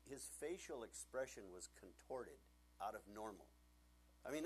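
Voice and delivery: ordinary talk, nothing marked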